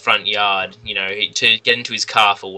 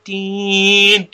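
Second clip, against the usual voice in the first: long, high sung "ding"; dramatic music sting; 10 semitones higher, 5 dB louder than usual. Retold or imitated. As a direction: imitate a scene-ending sting, loud and sustained. Imitates dramatic music before blackout.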